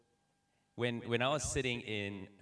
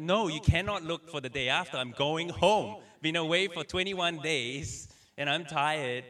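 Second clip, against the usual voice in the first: higher, louder, slowed protest; frustrated outburst; 6 semitones higher, 7 dB louder than usual. Retold or imitated. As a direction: push back, fed up and exhausted. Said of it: "frustrated and mad".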